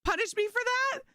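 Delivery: a bemused principal who can't believe it; high mock disbelief; incredulous principal. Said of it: "He's like".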